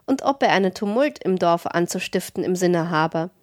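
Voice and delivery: plain reading voice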